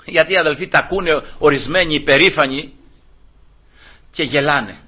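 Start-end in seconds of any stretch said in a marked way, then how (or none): none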